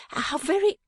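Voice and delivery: high, broken-off appeal; flustered protest. A narrator's reading. Daughter pleading with mamma, flustered. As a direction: urge it high and leave it hanging.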